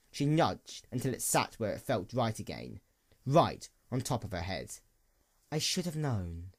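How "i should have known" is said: soft muttered self-reproach, much softer; a quiet, broken princess muttering under her breath. A narrator's reading. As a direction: mutter it almost to yourself. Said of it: "the princess muttered".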